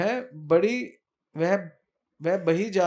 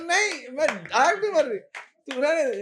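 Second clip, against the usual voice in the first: coy denial; 8 semitones higher, 5 dB louder than usual. Acted. Krishna denies winking, high voice.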